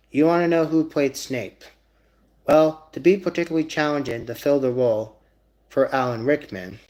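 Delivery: usual speaking voice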